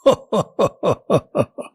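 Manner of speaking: king's ho-ho-ho laugh; hearty royal laugh. A narrator's reading. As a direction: burst into a string of even chuckles. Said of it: "राजा खिलखिलाकर हंस पड़े". (राजा की खिलखिलाती हंसी)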